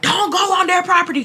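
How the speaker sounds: high-pitched mock call of warning; retold dad's yell. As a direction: imitate a dad calling out a warning. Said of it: "My dad starts yelling".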